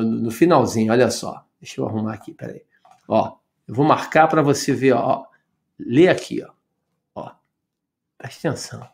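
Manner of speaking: usual speaking voice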